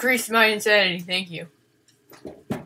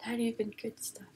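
soft whispered aside; 14 dB quieter than usual. Real, not acted. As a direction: breathe it out under the breath. Mutters quietly aside.

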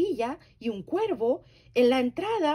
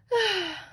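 contented sigh; 11 semitones higher, 3 dB louder than usual. Acted. Bear sighs contentedly.

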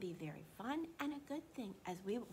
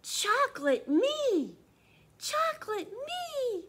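much higher, louder, delighted cry; joyful shout; 13 semitones higher, 10 dB louder, slower than usual. Acted. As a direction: shout it with pure joy. Boy's joyful exclamation.

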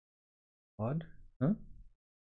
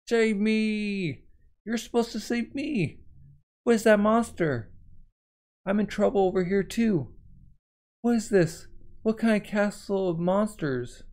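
higher pleading cries; panicked Nami; 6 semitones higher than usual. Acted. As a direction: beg to be saved, frantic.

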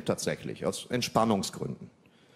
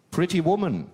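higher, lying-in-wait Pretty Woman; lurking tone; 9 semitones higher, 5 dB louder than usual. Retold or imitated. Girlfriend picking the film with a lurking, higher tone. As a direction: set the trap sweetly. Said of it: "Ihre Stimme hatte was Lauerndes".

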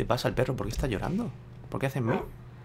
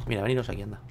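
ordinary delivery

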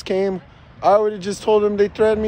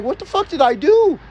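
retold indignant disbelief; 7 semitones higher, 5 dB louder than usual. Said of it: "I was like".

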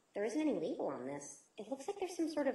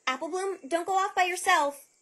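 raised warning call; 7 semitones higher, 8 dB louder than usual. Acted. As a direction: call after her, raised. Calling after wandering Apple Bloom.

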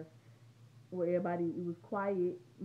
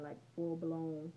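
normal talking voice

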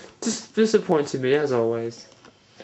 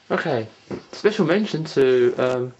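ordinary delivery

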